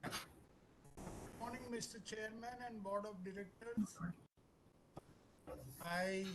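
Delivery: very faint, far from the mic; barely audible. Real, not acted. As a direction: voice barely coming through. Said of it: "So your voice is very low".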